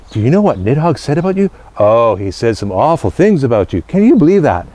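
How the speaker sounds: a mischief-maker passing on juicy rumours; gossiping squirrel. Acted. Squirrel gossiping to the hawk about the dragon, lightly voiced.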